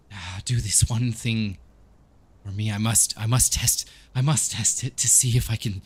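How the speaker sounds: halting plea building to a loud, desperate insistence; conflicted vampire character. Acted. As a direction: start hesitant, then beg with growing force. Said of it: "he says".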